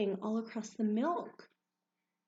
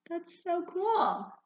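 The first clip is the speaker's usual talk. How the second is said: high, slow, loud amazement; kindergarten teacher voice; 9 semitones higher, 6 dB louder, slower than usual. Acted. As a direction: gush with wonder. Designs in milk.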